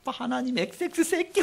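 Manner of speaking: censored curse, half-laughing; retold cursing. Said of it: "욕을 막 거기다도 막 퍼붓은 거예요".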